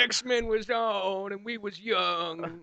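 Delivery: put-on slow, wistful old-timer voice, lightly done; hammed-up nostalgia, a little slow; mock nostalgic old-timer. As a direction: reminisce like a grandpa on his porch, slowly. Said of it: "start reminiscing about, like, the olden days".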